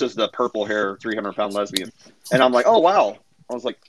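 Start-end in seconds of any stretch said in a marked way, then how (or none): 2.7-3.2 higher, louder fake awe; sarcastic oh wow; mock impressed. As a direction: act dazzled, sarcastically. Mock impressed reaction to expert claim.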